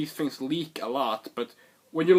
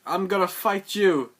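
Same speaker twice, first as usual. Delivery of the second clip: higher and louder defiant call; mock challenge shout; 7 semitones higher, 5 dB louder than usual. Finn challenging Kylo Ren, loud defiant shout. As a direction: throw down the challenge. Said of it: "Finn is like".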